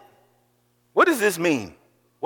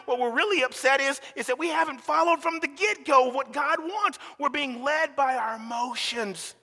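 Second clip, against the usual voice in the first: ordinary talk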